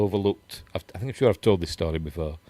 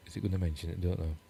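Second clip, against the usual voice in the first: softer echo of the question just asked; quiet aside to self; 3 semitones lower, 10 dB quieter, much faster than usual. Real, not acted. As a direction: repeat it much softer, under your breath. Repeats it to himself, much softer.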